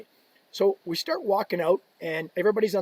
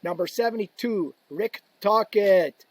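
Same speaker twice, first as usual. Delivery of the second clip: drawn-out announcer call, raised pitch; arena announcer; 4 semitones higher, 4 dB louder, slower than usual. Retold or imitated. Introducing players to the crowd, announcer voice. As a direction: announce the player slowly, stretching name and city. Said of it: "they'd go".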